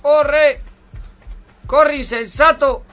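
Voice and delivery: high, loud panicked orders; urgent shouting. Real, not acted. Gamer to freezing character running for fire, urgent shouting. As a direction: yell commands at top pitch. Urging dying character to run.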